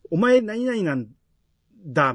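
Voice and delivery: mock insolent talk, drawn out; cheeky. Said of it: "生意気というか". (生意気な口ぶりを真似て)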